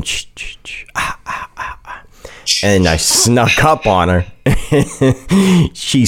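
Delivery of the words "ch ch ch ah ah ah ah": unvoiced spooky ch-ch-ah hiss; sneaking-up whisper to scare his girlfriend; creepy whispered hiss. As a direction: hiss it like a horror-movie stalker in the dark. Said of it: "hallway going".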